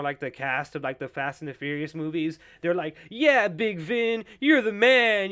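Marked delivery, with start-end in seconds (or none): 3.1-5.3 loud, high, drawn-out cheering; mock hype. Cast members flattering their star, mock hype. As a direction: hype him up like a fawning entourage. Imitating castmates hyping up Vin.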